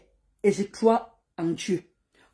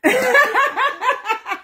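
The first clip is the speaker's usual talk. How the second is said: a real laugh breaking out; 11 semitones higher than usual. Real, not acted. Laughing as she greets her live viewers.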